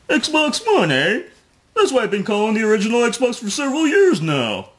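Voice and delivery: lightly voiced mocking Joe impression; a hint of a mock Joe; Joe Siegler voice. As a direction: read his post in a put-on snooty voice. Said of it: "my Joe Siegler voice".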